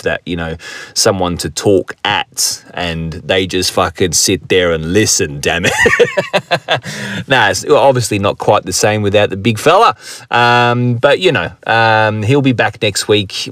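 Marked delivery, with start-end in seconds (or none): 5.8-7.2 bright laugh at his own joke; high cackling laugh. Real, not acted. Laughing at his own soundboard joke. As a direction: let out a big laugh.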